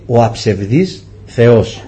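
slow, low and solemn; solemn voice of God. Retold or imitated. God answers the frightened father, slow and low.

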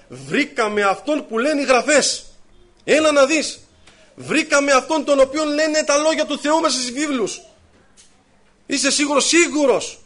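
excited news. Retold or imitated. Philip excitedly tells Nathanael.

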